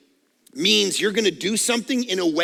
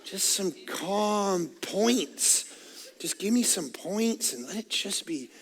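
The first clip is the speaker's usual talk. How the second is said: much softer, slowed chill voice; calm down; 2 semitones lower, 11 dB quieter, slower than usual. Retold or imitated. Pastor imitating a tired listener asking for calm, much softer. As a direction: ease it down, mock-calm and soft. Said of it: "We don't need you yelling".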